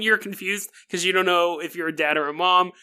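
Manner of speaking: ordinary delivery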